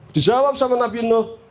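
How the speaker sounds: usual speaking voice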